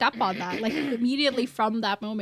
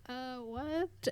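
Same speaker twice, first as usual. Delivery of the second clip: very soft, befuddled drunk imitation; playing a drunk who can't process it; drunk confusion; 2 semitones higher, 13 dB quieter than usual. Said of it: "he's so drunk, he can't even, like, process".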